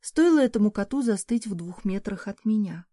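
ordinary delivery

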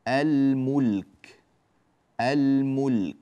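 الملك with ل held before م; slow, careful model of الملك; clear lam demo. Shown as a demonstration. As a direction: linger on both ل sounds in الملك. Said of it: "هكذا اللامات ظاهرة هنا".

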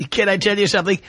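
normal talking voice

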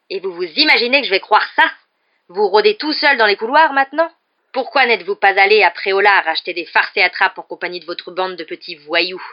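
loud, disbelieving tirade; barked scolding. A narrator's reading. Caretaker berates pupil roaming the corridors alone, barking. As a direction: berate him, stretching the final insult. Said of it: "aboya Rusard".

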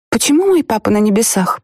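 put-on little girl voice. A narrator's reading. Narrator voices child.

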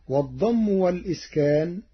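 ordinary delivery

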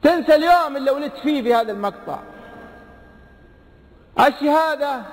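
high, pressing warning; impassioned preaching. Acted. Preacher warning the congregation about the death throes, impassioned. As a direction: call it out to the hall.

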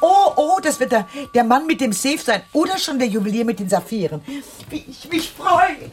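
high, loud and thrilled; excited. Acted. Aunt thrilled that the safe man is arriving, high excited gush. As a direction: gush with delight over the coming visitor.

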